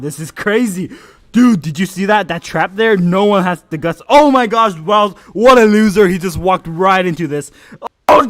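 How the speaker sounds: loud, excited caster calls; hyped play-by-play. Acted. Streamer casting his friends' match, excited play-by-play. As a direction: call every play like a shoutcaster.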